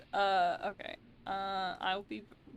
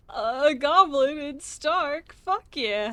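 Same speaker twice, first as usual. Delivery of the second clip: excited; 6 semitones higher, 11 dB louder, slower than usual. Retold or imitated. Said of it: "I was like".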